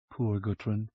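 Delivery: softly, full of sympathy; tender pity. Acted. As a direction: soothe her gently. Vorsheva consoles.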